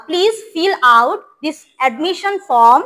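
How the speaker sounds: very slow, clear drill; slow model reading. Shown as a demonstration. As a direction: spell it out word by word for the class. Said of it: "আহ". (ধীরে ধীরে স্পষ্ট করে বলা)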